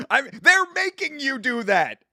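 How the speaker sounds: real laugh breaking through the words, high and loud. Real, not acted. Streamer laughing at the chat's joke, real laughter.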